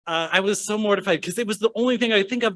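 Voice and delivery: plain reading voice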